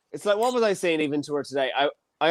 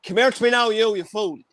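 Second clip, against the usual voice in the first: higher, hammed-up Irish accent; getting out the Irishness; 4 semitones higher than usual. Co-host playfully summoning Spanish girlfriend, thick Irish accent. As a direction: lay on the Irish, playfully bossy. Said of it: "I get out the Irishness".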